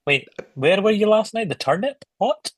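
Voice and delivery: high, puzzled questions; mock confusion, pitch rising; baffled. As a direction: act totally lost. Imagined baffled self.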